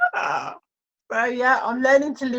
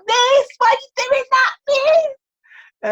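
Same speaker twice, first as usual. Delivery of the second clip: high, louder mock pupil voice; imitating students; 13 semitones higher, 5 dB louder, slower than usual. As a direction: play the giggling pupils calling out to their teacher. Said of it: "they would just laugh like".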